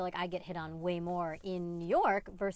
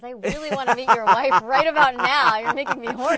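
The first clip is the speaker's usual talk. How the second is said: louder, playfully teasing exclamation; mock flirty outburst; 14 dB louder than usual. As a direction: tease him loudly, half-joking. Joking about meeting his wife.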